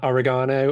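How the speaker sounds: oregano as "o-ri-GAH-no"; British pronunciation. Shown as a demonstration. Brit offering a classic example, oregano as o-ri-GAH-no. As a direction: stress oregano on a long gah. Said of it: "is another classic".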